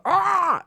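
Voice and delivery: high, loud mock yell "Ah!"; looping yell. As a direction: imitate the glitching scream.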